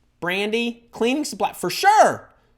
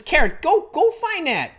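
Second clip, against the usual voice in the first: eager urging; 13 semitones higher, 3 dB louder than usual. Real, not acted. Urging Kara.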